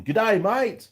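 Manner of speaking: loud, high, hammed-up Aussie "g'day" accent; badly faked Aussie accent, belted out high; terrible Australian-style accent. Vlogger greeting a viewer with a jokey, terrible Aussie accent. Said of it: "Terrible accent, sorry, I just couldn't resist".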